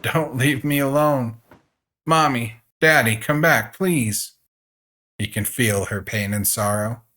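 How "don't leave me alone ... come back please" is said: put-on high, loud desperate child's plea; pleading child calling after her parents; crying plea. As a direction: beg them to come back through tears. Dying girl begs absent parents.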